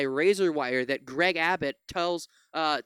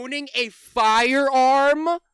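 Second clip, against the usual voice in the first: indignant rise; 5 semitones higher, 9 dB louder, much slower than usual. Real, not acted. Outraged rhetorical question.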